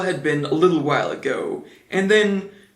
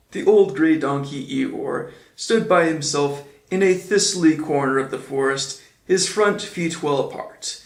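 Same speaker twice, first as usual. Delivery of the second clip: usual speaking voice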